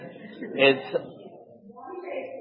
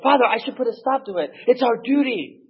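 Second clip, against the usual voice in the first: high, fast and eager; earnest young priest; 11 semitones higher than usual. Retold or imitated. Young priest urging the old priest, high and eager. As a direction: insist it's their duty. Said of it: "He's like".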